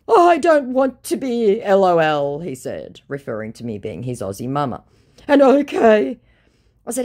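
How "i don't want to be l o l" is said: high, pleading mock voice of the scammer. Reading the scammer's message aloud in a high mock voice. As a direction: play the whiny scammer, pitched up.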